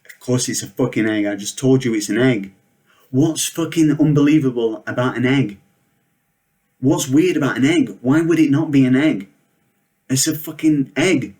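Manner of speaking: building from heated to a loud high rant; mounting real rage. Real, not acted. Ranting to the audience about the smashed egg, building furious shout. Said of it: "I gotta compose myself".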